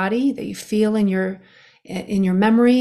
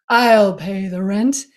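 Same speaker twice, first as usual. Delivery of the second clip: slow, bold heroic announcement; melodrama hero; 4 dB louder, slower than usual. Pretend. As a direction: declare it like a gallant rescuer. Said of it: "this is the world's shortest play".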